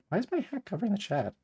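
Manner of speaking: high-pitched, puzzled whine; baffled complaint. Real, not acted. Baffled that hat blocks chat.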